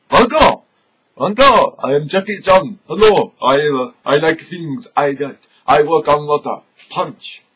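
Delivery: lightly hammed Jackie Chan voice; Jackie Chan impression. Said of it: "Jackie Chan gimmick".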